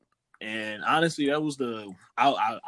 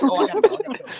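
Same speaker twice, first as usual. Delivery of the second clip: put-on hurried girl; 9 dB louder, much faster than usual. Girl hurrying off the call.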